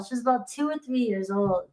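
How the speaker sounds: ordinary talk, nothing marked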